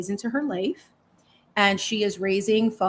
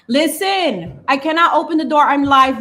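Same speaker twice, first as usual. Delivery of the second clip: exasperated; 5 semitones higher, 6 dB louder than usual. Real, not acted. Scolding cat at door.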